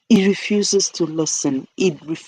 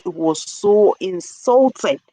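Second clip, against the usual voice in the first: ordinary delivery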